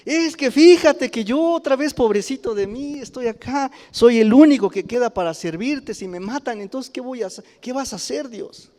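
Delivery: high pleading whine of poor Elijah; mock Elijah, pitying himself; whiny self-pity. Preacher mimics Elijah whining to God, high and pleading. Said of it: "no lo dijo así".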